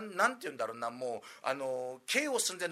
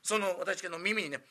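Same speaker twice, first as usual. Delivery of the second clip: ordinary delivery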